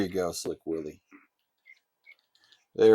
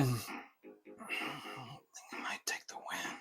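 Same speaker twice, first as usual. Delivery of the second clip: breathless whisper, much softer; hushed whisper; 3 semitones higher, 16 dB quieter, slower than usual. Real, not acted. Gamer whispers his hope of winning, hushed whisper. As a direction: whisper it softly so as not to jinx the win.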